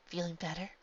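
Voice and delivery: soft, tender check-in; gentle question. A narrator's reading. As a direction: ask her softly if she's okay. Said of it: "the princess asked gently".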